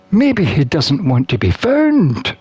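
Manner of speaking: usual speaking voice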